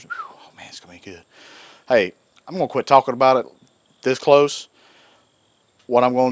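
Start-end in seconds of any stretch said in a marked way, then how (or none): 0.0-1.2 soft unvoiced whisper of delight; excited whisper. Real, not acted. Cook whispering his excitement about cheesesteak to viewers, hushed. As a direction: whisper it like a secret treat.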